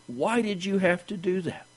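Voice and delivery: high, soft reproachful question; pleading high-pitched preacher's question; remonstrating. Acted. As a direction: lift the voice high and soften it. Voices Peter questioning Ananias.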